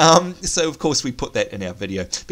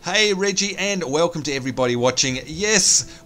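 usual speaking voice

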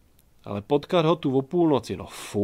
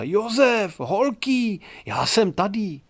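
high, louder joyful calls; happy calling; 8 semitones higher, 4 dB louder, slower than usual. A narrator's reading. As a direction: shout to his friends in the forest. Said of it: "volal šťastný Jan Čmelda". (radostné volání na přátele)

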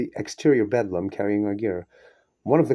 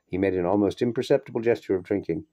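ordinary delivery

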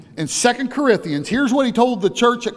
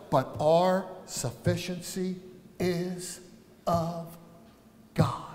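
slow soft emphasis; 4 semitones lower, 9 dB quieter, much slower than usual. Acted.

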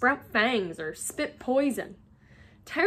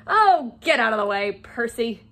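brash dismissal; 8 dB louder than usual. A narrator's reading. Fred waving off disapproving Percy, brashly dismissive. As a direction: brush him aside loudly.

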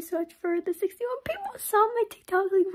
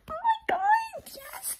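much higher, breaking squeal of delight; squealing with joy; much slower than usual. Retold or imitated. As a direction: squeak it out, overjoyed. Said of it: "I was like".

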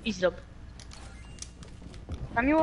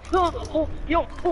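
excited shrieks; 7 semitones higher than usual. Real, not acted. Gamer shrieking at close fight.

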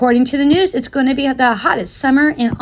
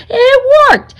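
higher and much louder burst of joy; thrilled yell on the court; excited shout; 13 semitones higher, 7 dB louder than usual. Acted. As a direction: shout it as the move lands. His crossover move succeeds.

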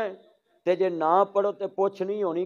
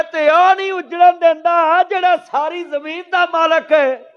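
loud, high, ringing pulpit shout; full-force preaching; 9 semitones higher, 9 dB louder than usual. Real, not acted. Preacher challenging listeners who missed the point, full-force preaching. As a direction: hurl the argument at the crowd at full voice.

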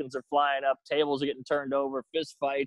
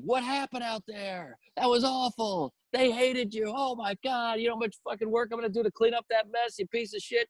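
higher mock-furious rant; angry host; 8 semitones higher than usual. Host chewing out the comedian after the disastrous set. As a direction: tear into him like a livid boss.

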